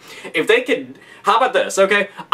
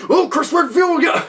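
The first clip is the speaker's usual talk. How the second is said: cocky hero boast; 3 semitones higher than usual. Retold or imitated. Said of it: "He's just like".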